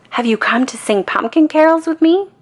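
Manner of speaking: high, loud and eager; thrilled to see friends arrive; excited. A narrator's reading. Linus greeting his friends, excited and eager. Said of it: "gets excited".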